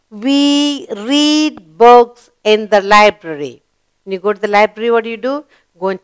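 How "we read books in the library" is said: slow, clear model reading; slow read-aloud. Shown as a demonstration. Teacher reading the finished sentence to pupils, slowly. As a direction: say each word slowly for the class. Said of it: "we completed the sentence".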